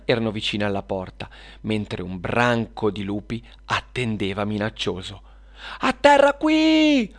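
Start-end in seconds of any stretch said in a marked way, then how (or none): 5.8-7.1 very high, drawn-out loud call; yelling. Acted. Serena calls flying Luna down to land, yelling. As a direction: holler it slowly to someone far above. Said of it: "urlò Serena".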